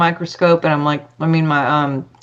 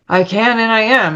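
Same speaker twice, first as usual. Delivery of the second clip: higher, more deliberate affirmation; retold declaration with conviction; meaning it; 5 semitones higher than usual. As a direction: say it like you mean it. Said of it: "say it like you mean it".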